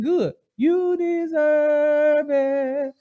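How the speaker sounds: slow, drawn-out high mock gloat; smug. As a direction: savor it like a spiteful judge. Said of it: "we said".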